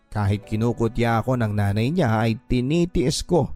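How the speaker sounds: ordinary talk, nothing marked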